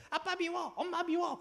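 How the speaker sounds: put-on high, whiny little-kid voice; sulking child. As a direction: pout like a sulky child. (높고 칭얼대는 아이 목소리)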